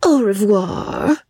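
breathy, slow parting kiss; flirtatious farewell. A narrator's reading. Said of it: "kissing her hand to Joss".

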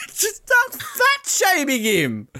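very high, slow, laughing disbelief; incredulous outburst. Real, not acted. Host reacts to the commentator fat shaming, incredulous outburst. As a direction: squeal it in amused outrage.

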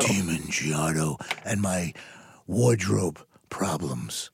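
low, very slow, mock-solemn announcer; mock title announcement. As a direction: announce it like a grave title, slowly. Mock title for listener's question.

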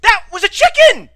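high, very loud boastful yelling; triumphant shouting. Pretend. Podcaster proving his bird impressions to a critic, triumphant shouting. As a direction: crow about it at the top of your voice.

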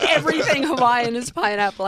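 laughing mockery. Mocking quote while laughing.

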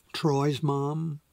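ordinary delivery

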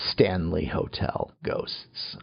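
low slow spooky intro. Pretend. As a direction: drop into a spooky announcer. Spooky announcement of topic.

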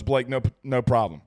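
ordinary talk

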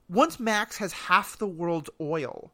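ordinary talk, nothing marked

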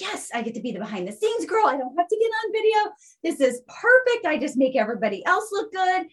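excited, high-pitched self-quote; thrilled, voice leaping up high; gleeful relief. Retold or imitated. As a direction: gush it out like you've got off scot-free. Acts out her delighted past self.

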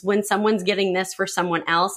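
ordinary talk

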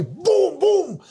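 high, drawn-out gun booms; imitated gunshots. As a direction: boom out shots as in a retold shootout. Re-enacting firing into the kitchen.

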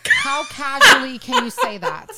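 amused, drawn-out ask; laughing. Real, not acted. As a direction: laugh out the question. Laughing question.

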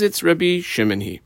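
ordinary talk, nothing marked

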